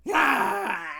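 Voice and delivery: loud charging yell; mock roar. Charging the students with the bird, loud roar. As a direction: roar to scare the crowd.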